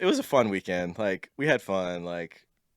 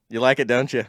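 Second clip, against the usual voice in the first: put-on Minnesota accent; 4 semitones higher, 7 dB louder than usual. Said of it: "her little Minnesota accent".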